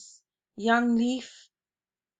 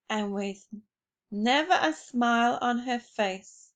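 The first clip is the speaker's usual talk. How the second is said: ordinary delivery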